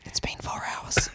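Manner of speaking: bored whisper. Retold or imitated. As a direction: whisper it, fed up. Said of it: "I was like".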